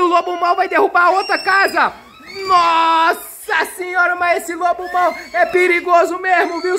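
high, shouted play fright; lively mock scare; mock alarm. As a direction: ham up the danger for the kids.

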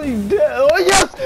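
real high-pitched scream of triumph; yelling for joy. Real, not acted. Gamer gets the helmet he hoped for, yelling for joy. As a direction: erupt into a high, drawn-out squeal. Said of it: "I'm sorry for yelling".

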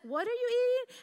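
high, baffled mock question; puzzled question. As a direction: ask it incredulously. Boyfriend asking, retold.

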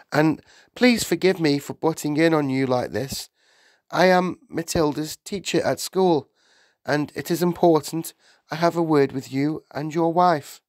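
earnest teacher asking for a word; polite Miss Honey. A narrator's reading. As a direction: apologise politely but press the point.

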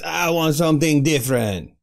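mock Italian baron with a French-sounding accent; badly faked Italian accent, slipping into French; French-ish Italian accent. Aristocrat baron demanding a new dish, in a fake French-Italian accent. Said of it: "that's more of a French accent".

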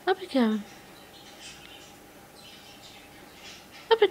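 high, sing-song coaxing to a bird; pet talk voice. Real, not acted. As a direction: coax a shy budgie out. Coaxing bird onto finger.

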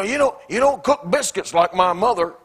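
hammed-up critical husband; mock critical husband. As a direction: whine about the biscuits. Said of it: "You know".

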